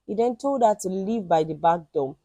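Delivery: ordinary delivery, nothing marked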